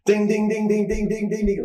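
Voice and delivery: high ringing ding ding ding like a slot machine; excited high slot machine bell noise; jackpot ding imitation. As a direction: sing out the bells of a jackpot.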